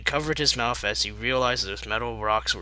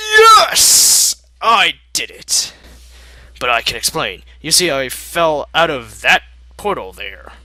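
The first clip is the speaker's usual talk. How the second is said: loud, much higher blurted admission; panicked confession; 4 semitones higher, 11 dB louder than usual. Acted. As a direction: cracks under pressure and shouts it out.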